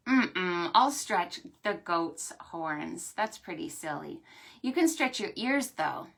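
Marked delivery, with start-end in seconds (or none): none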